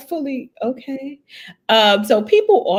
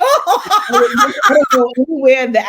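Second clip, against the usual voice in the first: louder, laughing through the words; 8 semitones higher, 10 dB louder, slower than usual. Real, not acted. Joking to the host that college wasn't far, laughing. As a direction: laugh as you say it.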